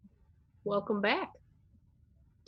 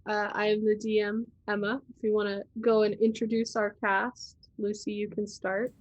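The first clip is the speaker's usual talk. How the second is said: ordinary talk, nothing marked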